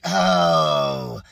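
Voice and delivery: a low drawn-out groaning oh; groaning oh of dread; deep groan. Retold or imitated. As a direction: sink into a deep comic groan. Dread about the trash.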